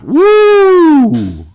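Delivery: whooping cheer, very high and loud; high excited woo. Real, not acted. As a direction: let out a big excited whoop. Cheering a fun fact.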